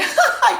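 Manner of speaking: high, loud jeering laugh; mocking laugh. Soldier jeering at Jesus, high loud mocking laugh. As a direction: cackle at him like a cruel soldier.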